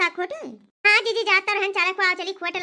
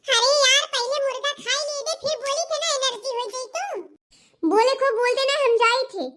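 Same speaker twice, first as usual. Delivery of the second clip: put-on coy girlfriend; 5 semitones higher than usual. Girlfriend voice, higher.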